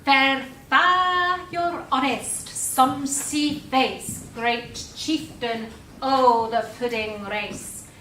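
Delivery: slow, measured reading of Burns' verse; a careful, slow stab at Scots verse; poem recital. Pretend. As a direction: read it out slowly like a Burns Night address. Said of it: "have a go at reading a bit of Robert Burns".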